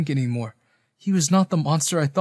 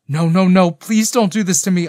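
loud, pleading cry, pitched up; fearful whimper; 4 semitones higher, 9 dB louder than usual. A narrator's reading. Pleading with the dying Denzel in the cave, frightened whimper. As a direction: beg in fear, voice raised. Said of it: "I whimpered, feeling a wave of fear".

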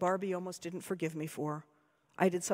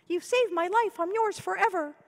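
high, louder squeak of a swooning doll; mock-dramatic doll squealing her devotion; squeaky; 12 semitones higher, 5 dB louder than usual. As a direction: squeak it like a toy in love. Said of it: "I half expected her to squeak".

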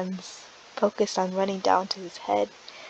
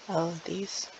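quiet, evasive; 2 semitones lower, 7 dB quieter than usual. Acted. Alan deflecting about scars.

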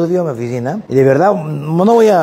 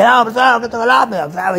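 mock eager student; 6 semitones higher than usual. Imitating the eager interrupting student.

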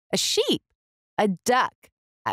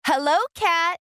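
much higher, bright little duck greeting; cheerful duck voice; 9 semitones higher than usual. A narrator's reading. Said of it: "said Duck".

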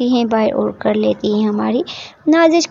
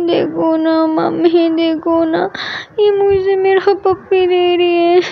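put-on high, slow childish complaining; whiny little girl; 6 semitones higher, slower than usual. Little girl complaining to mummy, high and whiny. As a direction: whine to mummy like a small child.

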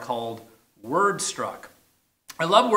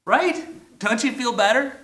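much higher, bright coaxing; playful appeal; 8 semitones higher, 3 dB louder than usual. Real, not acted. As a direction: coax them, teasing.

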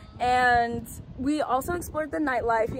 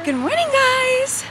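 singsong greeting; 12 semitones higher, 5 dB louder, slower than usual. Real, not acted. Cheery singsong vlog greeting.

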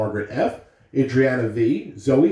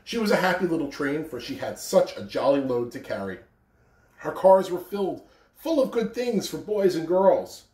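gentle storybook reading; reading to children; 4 semitones higher than usual. A narrator's reading. Principal reading The Little Engine That Could to children, gentle. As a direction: read it like a picture book to kids. Reading a picture book aloud.

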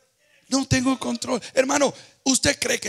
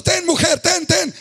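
mock panicked handoff; 8 semitones higher, 3 dB louder than usual. Overwhelmed husband hands back baby.

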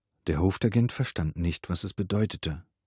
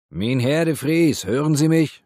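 loud, higher-pitched call into the flat; raised, carrying call; 8 semitones higher, 8 dB louder than usual. A narrator's reading. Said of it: "rief er ins Innere der Wohnung".

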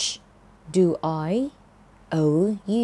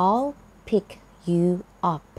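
each word said separately with pauses between; much slower than usual. Shown as a demonstration. Teacher giving learners the words one at a time, slowly and separately. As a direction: break "I'll pick you up" into single words for the learner. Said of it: "คำว่า pick up แปลว่าไปรับค่ะ".

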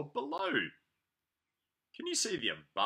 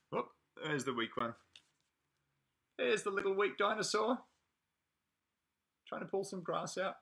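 ordinary talk

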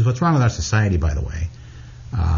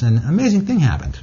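ordinary talk, nothing marked

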